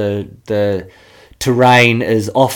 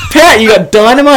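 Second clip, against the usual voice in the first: higher and louder eager question; excited disbelief; 10 semitones higher, 7 dB louder than usual. Retold or imitated. Boys asking Pat about dynamite, higher and excited. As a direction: ask it thrilled and half laughing.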